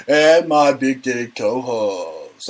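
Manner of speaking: very slow, drawn-out announcer intro; hammed-up stretched announcer call; mock announcer. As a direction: introduce her like a strip club host. Announces her like a club host.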